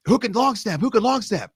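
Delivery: high, calling out to a line of players; coaches calling out. Retold or imitated. Coach calling to the players on the line, high call. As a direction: shout the question across the practice field.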